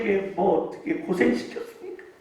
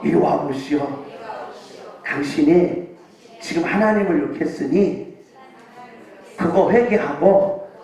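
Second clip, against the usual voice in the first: lower, measured voice addressing a blasphemer; calm rebuke to blasphemers; 6 semitones lower than usual. Shown as a demonstration. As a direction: speak it down low and steady for the church to repeat. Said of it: "따라서 합시다".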